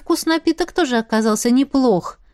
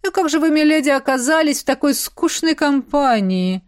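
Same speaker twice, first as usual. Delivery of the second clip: higher, teasing baron voice; smirking baron; 6 semitones higher than usual. A narrator's reading. Baron teases milady about her dull company, smirking. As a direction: ask with an audible smirk. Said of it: "усмехаясь".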